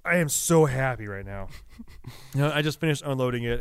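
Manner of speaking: usual speaking voice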